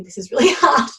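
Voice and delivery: laughing while struggling to show a pose; amused laugh. Real, not acted. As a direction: break into a real laugh at your own struggle.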